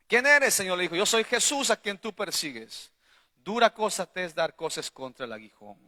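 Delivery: forceful scripture recital. Acted. Preacher recites Paul's encounter with Jesus to the church, forceful recital. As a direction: declaim the verse to the congregation.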